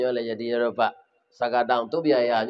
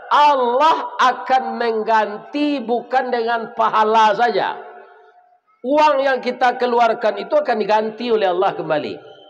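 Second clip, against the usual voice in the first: preaching exhortation; 7 semitones higher than usual. Acted. Closing exhortation.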